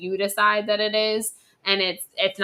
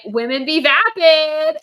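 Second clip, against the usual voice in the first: slow, loud, higher sneering mock; 6 semitones higher, 9 dB louder, slower than usual. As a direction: spit out the cliché as a parody.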